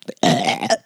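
belch it out deep; burp. Real, not acted. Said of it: "Excuse me, we start with a burp".

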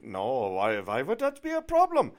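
mock German accent climbing high and loud; German realtor. German realtor reassuring a worried client, loud rising imitation in German accent. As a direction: imitate a German woman dismissing the worry, rising sharply. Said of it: "tell by the accent she's obviously from Germany".

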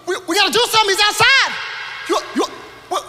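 high, loud and hurried; panicky servant. Retold or imitated. Servant urging Elisha to go, high and frantic. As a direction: stumble over the words in a rush.

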